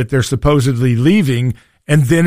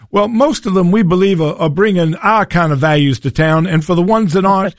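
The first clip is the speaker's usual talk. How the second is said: put-on South Carolina accent; 4 semitones higher than usual. Host retelling the governor's answer at a panel, light South Carolina accent. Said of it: "he responded in that great South Carolina accent".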